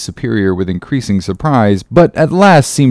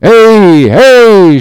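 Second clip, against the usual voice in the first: loud, high, drawn-out cheer; crew's shout; 8 semitones higher, 8 dB louder than usual. Acted. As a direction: answer the captain with a full shout. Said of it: "shouted the harpooneers and seamen".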